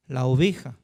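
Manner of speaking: ordinary talk, nothing marked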